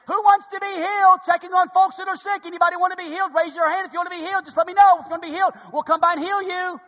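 high, loud, fast hawking; town crier with bell. Acted. Healer touting cures round villages, hammed-up calling out. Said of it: "with a bell, ringing it, saying".

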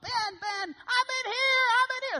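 very high, strange calling out; squeaky high call from the closet; Ben's high voice. Retold or imitated. Ben calls out from the dark closet, very high and strange. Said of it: "Real high-pitched, strange".

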